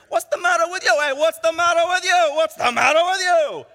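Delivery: high, loud New York street argument; shouting New Yorkers. Acted. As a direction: holler it like quarrelling New Yorkers. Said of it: "imagine a bunch of New Yorkers here".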